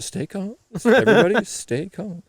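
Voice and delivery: high-pitched, held-back urging; comic high plea for calm; mock calming. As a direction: urge calm in a high, keyed-up voice. Acting out mock order to stay calm.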